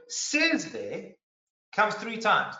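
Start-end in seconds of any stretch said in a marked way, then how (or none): 0.1-0.7 says jumps up high and stands apart; stress on says. Shown as a demonstration. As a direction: hold up says as the key word. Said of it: "So we see the word".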